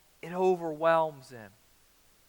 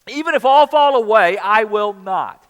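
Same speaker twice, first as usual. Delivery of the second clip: loud, high-pitched boast, slowing on the last words; brash vow, shouted high and then stretched out; bold declaration; 6 semitones higher, 14 dB louder than usual. Acted. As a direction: Peter puffing himself up. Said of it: "Peter declared".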